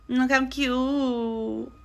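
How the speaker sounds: high, very drawn-out coo; gushing. Real, not acted. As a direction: coo over a cute photo, slowly. Gushing over photos of parents.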